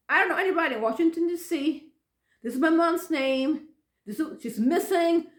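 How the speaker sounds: re-enacted desperate explaining; frantic protest.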